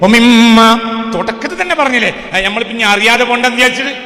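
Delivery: loud, high-pitched preacher's shout; full-force preaching. Real, not acted. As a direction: thunder it at the congregation. (ഉച്ചത്തിൽ അലറിയുള്ള പ്രസംഗം)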